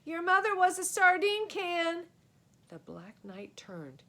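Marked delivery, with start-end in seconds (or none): 0.0-2.0 high, loud taunting yell. A narrator's reading. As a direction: hurl the insult loudly. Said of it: "And then he yelled".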